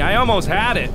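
usual speaking voice

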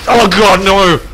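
loud, high cry of dismay; anguished shout. Real, not acted. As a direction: yell it in panic. Shot misses, squad at risk.

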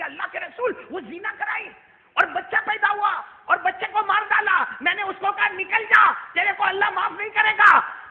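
high, loud, heated shouting; angry outburst. Acted. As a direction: defend himself in a rage. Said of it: "गुस्से में कहने लगे". (गुस्से में ऊँची आवाज़ में चिल्लाना)

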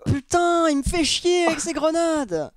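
high, slow exasperated rant; annoyed outburst. Real, not acted. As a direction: vent at the teammate. Annoyed at teammate. (ras-le-bol agacé)

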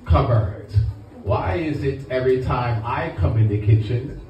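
lightly voiced Caribbean father scolding; a hint of Caribbean accent, nagging son's friend; Caribbean dad. Caribbean dad nagging his son's friend in the kitchen, lightly accented. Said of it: "I ain't never heard it done in Caribbean terms".

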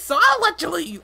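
bouncy little helper creature voice; high sidekick voice. Acted. Sidekick voice then echo.

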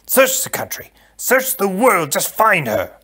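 urgent, loud order starting high; commanding prince. Acted. Prince sending the count to find a wife, urgent command. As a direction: order it forcefully, easing down in pitch.

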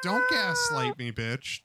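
high yelled protest; outraged yell. Real, not acted. Yelling at the cat not to gaslight him, outraged yell.

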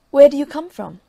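calls out sharply through the door to the stranger; loud wary call. Acted. Wife calls through the door to the knocking soldier, loud and wary. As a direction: shout the question through the closed door.

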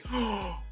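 sharp, high intake of breath; shocked gasp. Real, not acted. Gamer discovering who he is facing, startled gasp. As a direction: gasp in sudden realization.

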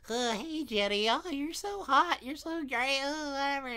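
high, far-off awkward nerd voice; nerdy kid. Retold or imitated. Friend mocking a nerdy kid crushing on a girl, high awkward voice. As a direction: swoon like an awkward nerdy boy. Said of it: "the nerdy kid who was like".

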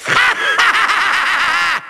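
long rattling villain laugh; theatrical rapid-fire evil laughter; evil cackle. Acted. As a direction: cackle in gloating triumph. Villain gloats.

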